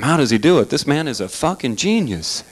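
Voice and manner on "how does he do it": usual speaking voice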